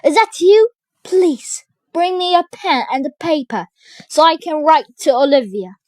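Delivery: high, loud pleading; urgent crying out. A narrator's reading. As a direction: beg through a door for help. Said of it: "cried Malvolio".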